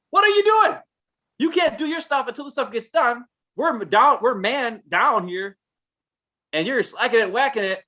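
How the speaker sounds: exasperated scolding. Retold or imitated. To coworkers standing around, exasperated high scolding. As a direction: chew out the slackers, pitch way up. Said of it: "And I'm like".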